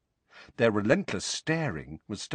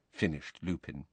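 plain reading voice

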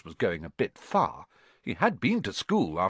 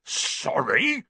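higher, incredulous bark, rising; 6 semitones higher than usual. A narrator's reading. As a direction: bark it back as a question. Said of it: "barked Hagrid".

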